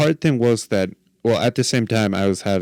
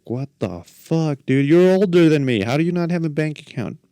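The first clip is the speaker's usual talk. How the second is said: exasperated disbelief; 7 semitones higher than usual. Retold or imitated. Exasperated at friend's excuse.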